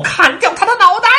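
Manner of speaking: very high, loud yell; bellow. A narrator's reading. Raging Queen ordering a beheading, loud yell. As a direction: yell the death sentence. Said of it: "不是大吼".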